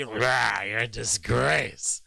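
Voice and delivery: slow, coarse mock Trump growl; a hammed-up grunting Trump jab; dirty piggy Trump tone. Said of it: "this kind of piggy, just very dirty".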